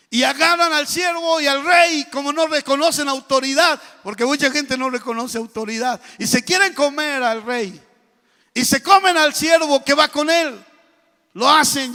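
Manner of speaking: loud, high fiery preaching; preaching at full force. Real, not acted. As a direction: build the story with pulpit fire.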